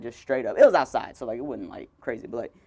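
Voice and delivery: normal talking voice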